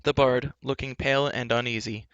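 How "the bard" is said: ordinary delivery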